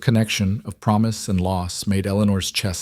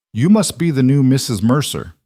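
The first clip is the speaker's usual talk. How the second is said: higher, pointed address without pleasantries; brusque greeting; 5 semitones higher, 4 dB louder than usual. A narrator's reading. Uncle confronting the new wife, brusque. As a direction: skip the niceties and confront her. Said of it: "he said, not bothering with pleasantries".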